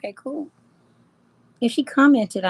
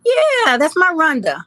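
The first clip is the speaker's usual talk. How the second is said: excited greeting; 7 semitones higher, 8 dB louder than usual. Real, not acted. Delighted to see old friend.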